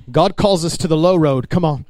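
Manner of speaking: normal talking voice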